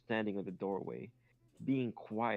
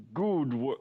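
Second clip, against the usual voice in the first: louder, hammed-up British accent; attempted British accent; 3 semitones higher, 8 dB louder than usual. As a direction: try a British accent and give up. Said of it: "how do you speak in a British accent".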